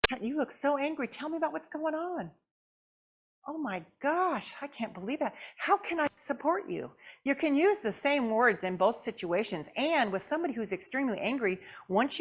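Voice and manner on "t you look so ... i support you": soft, caring sympathy; gentle validation. Shown as a demonstration. Counselor to an angry person, soft caring words. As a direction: speak softly to an angry person. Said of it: "say those same kind of words".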